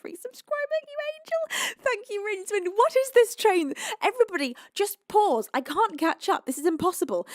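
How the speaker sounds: real giddy overwhelmed cries; overwhelmed squeal. Real, not acted. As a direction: squeal at the flood of gifts. Overwhelmed by gift train.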